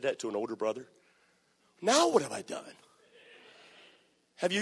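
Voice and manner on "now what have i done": raised, wounded "what did I do"; innocent protest. Acted. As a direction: sound falsely accused. [name] repeats protest.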